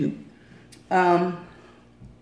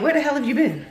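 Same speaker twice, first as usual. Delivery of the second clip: surprised; 6 semitones higher, faster than usual. Retold or imitated. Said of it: "I'm like".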